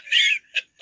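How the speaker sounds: a sudden loud, high-pitched laugh bursting out; burst of high laughter. Real, not acted. Telling about the stunned cop, a loud high laugh. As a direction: crack up at how shocked he was.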